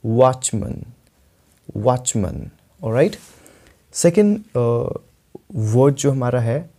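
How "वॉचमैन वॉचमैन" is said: reduced last vowel in watchman; watchman as "watch-mun". Shown as a demonstration. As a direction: demonstrate watchman with "mun" ending. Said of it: "आपको कैसे बोलना है".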